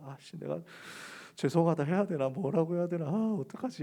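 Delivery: mild embarrassed mutter. Retold or imitated. Peter's embarrassed muttering, retold.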